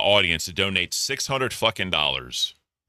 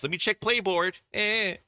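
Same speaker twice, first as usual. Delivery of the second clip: high, put-on whiny voice, much higher; mocking Boogie; 11 semitones higher, 3 dB quieter than usual. Host mocks Boogie checking the leaderboard, whiny high impression. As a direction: sneer it out like a lazy host checking numbers. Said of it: "And then Boogie's fat ass".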